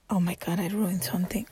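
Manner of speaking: hushed oh-no confession; shocked whisper. Real, not acted. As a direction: whisper it in dismay. Just broke her necklace.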